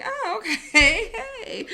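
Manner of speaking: slow, drawn-out laughing oh okay; laughing greeting. Retold or imitated. To the drunk guy at the bar, nervous laughing hello.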